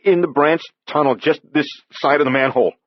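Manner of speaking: put-on young repairman, shaken, pointing back; shaky. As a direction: blurt it out scared. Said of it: "Andy said shakily".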